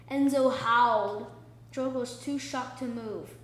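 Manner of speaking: ordinary delivery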